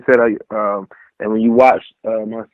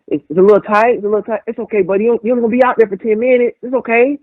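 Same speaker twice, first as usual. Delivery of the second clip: much higher, mock-soothing trainer voice, lightly done; imitating George reassuring; 10 semitones higher, faster than usual. George reassures Cam about the tight biker shorts in a mock-soothing higher voice. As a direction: play the trainer patting a nervous rookie on the back.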